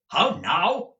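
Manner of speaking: stern challenge from a hard usurer. A narrator's reading. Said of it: "looking sternly round".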